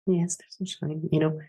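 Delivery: very soft, secretive reply; quiet conspiratorial murmur; hushed agreement. Acted. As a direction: agree under your breath. Answering quietly while hiding.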